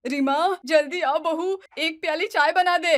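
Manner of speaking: loud call across the house; shouting. Acted. Mother-in-law shouting to daughter-in-law for tea, loud call. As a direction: yell for the daughter-in-law to hurry. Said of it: "ऊंची आवाज में चिल्लाती है".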